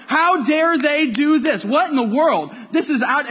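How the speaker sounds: loud, high-pitched indignant protest; angry outburst. Retold or imitated. Youth minister retelling his reaction to bad news, angry outburst. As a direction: raise pitch and volume in outrage. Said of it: "I'm getting angry, I'm getting frustrated".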